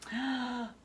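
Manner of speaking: ordinary delivery, nothing marked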